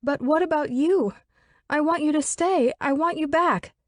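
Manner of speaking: high pleading female voice; distraught young woman. Acted. Elizabeth pleads with the absent Pike, high and distraught. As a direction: beg an unseen friend for help.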